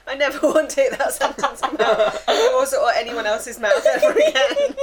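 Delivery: loud, high, talking through laughter; laughing. Real, not acted. Host reacting to co-host's crude line, laughing hard. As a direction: protest while cracking up.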